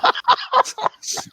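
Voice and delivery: laughing out high and airy; high breathy laugh. Real, not acted. Youngest host laughing at being teased, airy laugh. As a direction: burst out laughing. Teased about her age.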